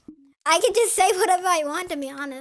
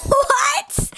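high, loud, stretched-out "what"; shocked laughing what; 11 semitones higher, 8 dB louder than usual. Real, not acted. Friend's odd remark gets a high, loud, incredulous what. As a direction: burst out in amused disbelief.

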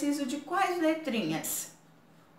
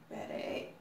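whispered aside; 14 dB quieter, faster than usual. Real, not acted. Aside during reading.

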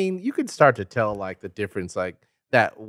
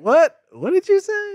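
much higher and louder mock accent; deep British accent; 9 dB louder, slower than usual. Imitating a thick British accent nobody understands. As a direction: squeal it like a deep regional Brit. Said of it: "some that are so deep".

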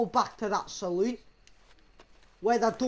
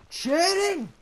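high, loud shout to leave; calling out; 5 semitones higher, 5 dB louder than usual. Pretend. Rider calling the horse to escape town, shouting. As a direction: holler it for the getaway.

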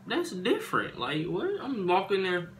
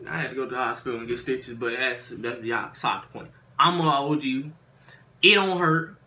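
usual speaking voice

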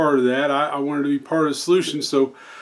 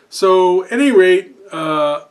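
usual speaking voice

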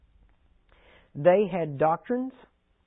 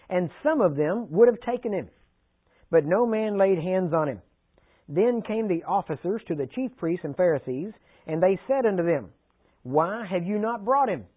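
slow, louder, solemn Bible reading; preacher reading John aloud to the congregation, slow and louder; scripture reading; 4 dB louder than usual. Acted.

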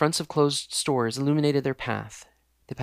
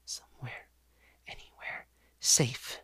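faint desperate whisper; whispered prayer; 6 dB quieter than usual. Acted. Exhausted girl begs for safety, whispering. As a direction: barely voice the plea. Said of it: "she prayed silently".

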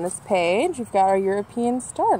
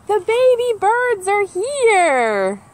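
higher, very slow, marvelling reveal; delighted reveal; 11 semitones higher, 4 dB louder, much slower than usual. Acted. Showing kids the hatched chicks, delighted and slow. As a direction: unveil the chicks like a treat.

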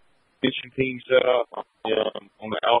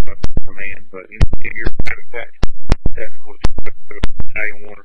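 urgent firefighter radio; 14 dB louder than usual. Real, not acted. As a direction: shout each word over the radio. Shouted fireground radio call.

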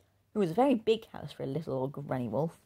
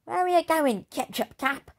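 much higher, bossy jeer; demanding bully; 11 semitones higher, 4 dB louder than usual. Acted. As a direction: demand where he's going. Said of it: "demanded the big bad girl".

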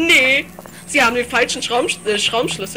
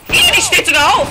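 high, loud shriek of disgusted fright; alarmed squeal; 7 semitones higher, 8 dB louder than usual. Real, not acted. Gamer watches the zombie rise, high alarmed squeal.

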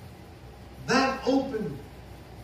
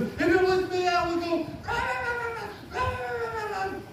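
squeaky imitation of a frantic rant; mock frantic fussing; 10 semitones higher than usual. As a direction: imitate someone flapping in a panic, very high. Cousin acts out her own fussing.

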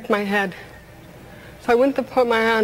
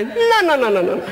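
defiant no; 5 semitones higher, slower than usual. Retold or imitated. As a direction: slam the door on fate. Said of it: "learned how to say".

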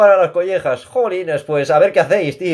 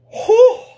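shaken gasp; 4 dB louder than usual. Real, not acted. Still shaken after the scare.

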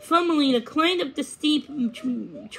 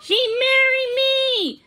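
very high, drawn-out yell; loud, slow, high-pitched toad yell; 14 semitones higher, 6 dB louder, slower than usual. A narrator's reading. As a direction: holler it while giving chase. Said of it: "Gruntle was yelling".

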